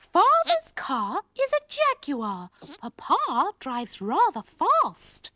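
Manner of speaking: slow, plummy received pronunciation; refined posh speech. Shown as a demonstration. Elocution lesson for Sweep, slow posh English. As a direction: speak terribly properly. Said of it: "more refined manner, like what I do".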